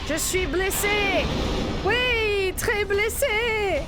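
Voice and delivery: high, hammed-up French wailing; mock French lament. Gamer playing wounded in French, mock-dramatic cry.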